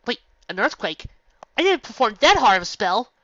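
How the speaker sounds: loud, high protest; can't believe what she hears; shocked disbelief. Acted. Twilight shocked by news.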